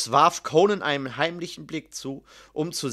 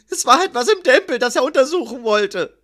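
high, loud, hurried blurting; frightened confession; 8 semitones higher, 7 dB louder than usual. A narrator's reading. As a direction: blurt it out before the fists fall. Scribe blurts answer under threatened fists. (hastig und ängstlich herausgeplatzt)

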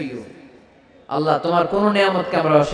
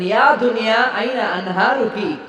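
calling out as God; 4 semitones higher than usual. A narrator's reading.

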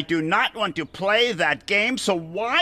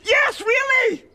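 exasperated cry; 5 dB louder, slower than usual. Acted. Bert insists.

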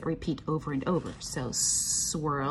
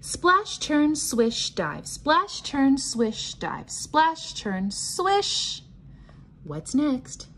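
lively sing-song read-aloud, chanted refrains higher; reading to children; 8 semitones higher, much slower than usual. Acted. Teacher reading a pattern book to the class, bouncy sing-song chant. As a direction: chant the refrains like a nursery rhyme for little ones.

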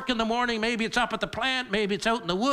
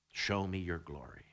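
hushed plea; 14 semitones lower, 10 dB quieter than usual. Acted. Quoting Moses' plea.

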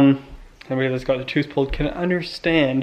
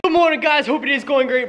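bright, high greeting voice; cheery hello to camera; upbeat vlog greeting; 10 semitones higher than usual. Shown as a demonstration. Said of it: "Hey guys, hope your day's going great".

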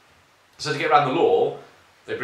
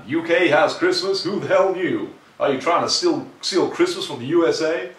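loud, high, bad American accent; hammed-up American accent, pitched up and loud; put-on American accent; 7 semitones higher, 7 dB louder than usual. Reading a viewer's Christmas question in a mock American accent. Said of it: "should do this in an American accent".

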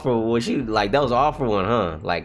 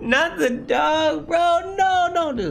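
anguished high wail; much slower than usual. Real, not acted. Dog about to be disintegrated.

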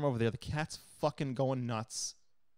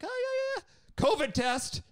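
very high, falsetto mock voice; mocking squeaky PA; 5 dB louder, much slower than usual. Imitating the PA on telehealth, squeaky mock voice. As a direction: parody her breezy dismissal. Mimicking the PA.

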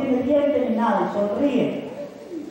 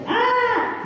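a sudden yelp, much higher, with a laugh; toddler's yelp; 12 semitones higher than usual. Retold or imitated. Preacher imitating a two-year-old yelping for its parents. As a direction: yelp like a startled little child. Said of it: "pega un grito del de dos añitos".

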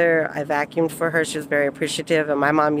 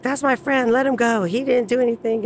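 higher, pleading mock yell; calling out to stop the arrest, higher; retold yell at the cop; 7 semitones higher than usual. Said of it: "I yelled at the cop".

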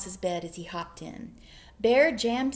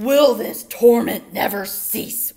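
slow, loud, anguished bear; loud wail; 2 semitones higher, 14 dB louder, slower than usual. A narrator's reading. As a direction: wail in dramatic torment. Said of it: "wailed Bear".

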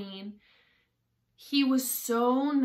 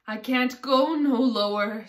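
loud, higher protest; exasperated, loud plea; protesting Hatter; 4 semitones higher, 9 dB louder than usual. A narrator's reading. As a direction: object in a raised voice.

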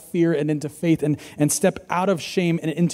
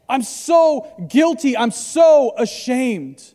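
higher, slow, drawn-out anguish without real tears; anguished self-reproach; 5 semitones higher than usual. Acted. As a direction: act out Peter's bitter regret, stretching the words. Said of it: "he's outside and he's weeping bitterly".

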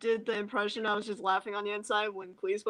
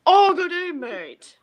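mild British accent, loud on good day; a light stab at a British accent; 9 dB louder, slower than usual. Shown as a demonstration. Friend tries a British accent on request, lightly British. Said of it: "a pretty good British accent".

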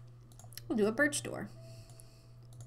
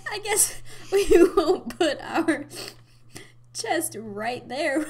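laughing, words squeezed out; slow, giggling through the words; 7 semitones higher, 10 dB louder, slower than usual. Real, not acted. Still laughing at the mishap.

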